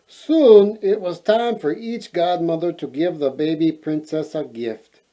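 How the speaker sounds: ordinary delivery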